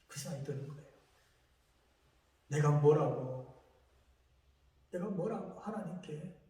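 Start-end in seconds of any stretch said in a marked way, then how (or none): none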